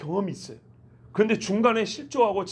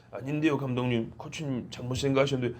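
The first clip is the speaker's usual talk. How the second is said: low, softer mock objection; muted protest; 7 semitones lower, 4 dB quieter than usual. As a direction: imitate an athlete mildly talking back under the coach. Acted athlete objecting to coach. (낮고 작게 흉내 낸 말대꾸)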